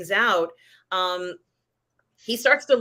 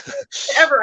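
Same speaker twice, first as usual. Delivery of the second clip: upbeat Andor pep talk; 7 semitones higher, 6 dB louder than usual. Retold or imitated. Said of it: "where he's like".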